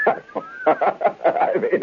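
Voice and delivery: high giggle into the words; can't hold back a laugh. Real, not acted. Storyteller chuckling at his own joke.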